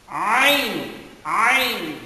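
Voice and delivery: Ain squeezed from the middle of the throat; throaty Arabic ain. Shown as a demonstration. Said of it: "Arabic letter ain".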